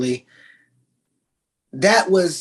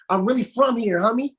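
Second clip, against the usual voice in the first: put-on street accent; 7 semitones higher than usual. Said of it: "talks like".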